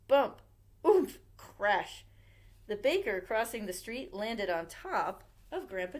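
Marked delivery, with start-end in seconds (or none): 0.1-2.0 sound effects. A narrator's reading.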